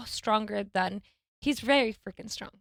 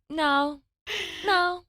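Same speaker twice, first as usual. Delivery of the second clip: put-on higher stubborn toddler no; toddler refusing; 6 semitones higher than usual. Son rejecting what parents do, imitating a stubborn no. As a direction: mimic a toddler's flat refusal. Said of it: "he'll just go".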